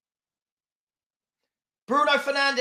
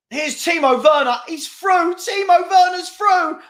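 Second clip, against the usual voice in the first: rising, louder as he breaks through; excited build-up; 7 semitones higher, 5 dB louder than usual. Real, not acted. Fan watching Werner break through, rising excitement. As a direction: voice climbs as the striker runs clear. Chance on goal in live match.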